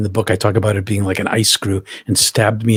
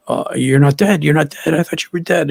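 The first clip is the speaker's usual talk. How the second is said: mock panicked cry, pitched much higher; imitating a frantic friend's relieved outburst; panicked relief; 6 semitones higher than usual. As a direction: friend in a panic finding him alive, high and frantic. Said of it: "he's like".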